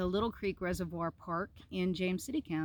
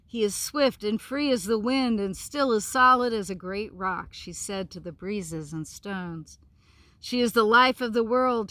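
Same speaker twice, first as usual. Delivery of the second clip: story narration; 6 dB louder than usual. A narrator's reading. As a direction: read it like a bedtime tale, savouring each line. Reads picture book aloud.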